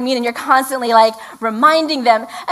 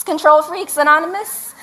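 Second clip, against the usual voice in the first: breaking into a high laugh; real laugh at her own joke; 5 semitones higher than usual. Real, not acted. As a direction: laugh through the punchline.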